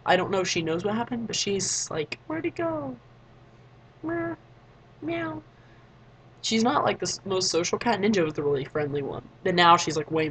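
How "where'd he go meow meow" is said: small, high, soft cat voice; plaintive, quiet high-pitched mock meowing; sad kitty. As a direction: voice a lonely cat mewing for a missing friend. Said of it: "she is sad".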